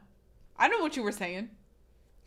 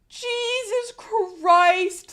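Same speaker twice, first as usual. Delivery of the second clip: very slow, higher, drawn-out cry; exaggerated shock; 12 semitones higher, 10 dB louder, much slower than usual. Streamer reacting to Sarah, slow high drawn-out shock. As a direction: stretch it in hammed disbelief.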